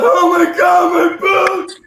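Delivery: very high, loud horrified cry; panicked shriek. Retold or imitated. Kid discovers own boots melting, panicked shriek. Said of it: "And I was like".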